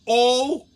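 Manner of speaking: "Oh" loudly parroted as a lone vowel; echoed vowel. Retold or imitated. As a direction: repeat "Oh" like a pupil. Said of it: "She goes".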